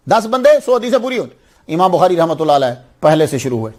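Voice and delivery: ordinary talk, nothing marked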